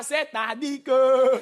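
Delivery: put-on Douala newcomer's accent, last word stretched out; Cameroonian accent imitation. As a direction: draw out the final word in a mock Cameroonian accent. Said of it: "tu parlais comment?". (accent camerounais appuyé, syllabe finale traînante)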